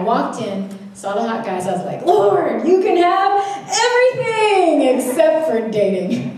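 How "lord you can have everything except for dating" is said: higher, louder, slow laughing plea; pleading bargain. Retold or imitated. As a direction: bargain sweetly with God. Bargaining with God, quoted.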